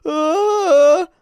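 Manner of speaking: high, loud wailing cry; mock scream of fear. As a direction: wail like a doomed character. Character fears dying, cries out.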